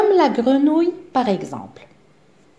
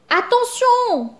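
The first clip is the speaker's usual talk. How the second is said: much higher, slow drawn-out warning cry; yelled warning; 13 semitones higher, slower than usual. A narrator's reading. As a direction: sound the alarm. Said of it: "hurle Zigomar".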